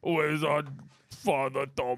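slurred gangster; Arthur Shelby voice. Pretend. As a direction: Birmingham gangster drawl. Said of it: "do Arthur Shelley".

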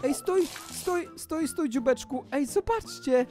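high-pitched fawning over something cute; gushing cooing. Pretend. Gamer meets a cute banana cat, gushing cooing. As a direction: coo sweetly, stretching the words.

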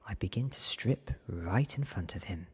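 ordinary delivery, nothing marked

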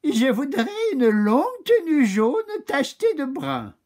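chirpy voice asking for a spotted coat; high animal voice. Acted. Cow asks the oak for a spotted yellow coat, lightly voiced high.

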